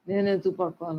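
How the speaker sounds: a quiet musing echo of నేను ఎందుకు పడుకోవాలి. Acted. Repeating the question to herself.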